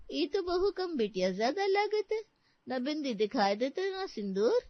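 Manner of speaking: high, drawn-out dialect voice; needling rustic laundry woman. Acted. Laundry woman remarks on bride's missing sindoor, needling rustic voice. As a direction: needle the bride like a village gossip.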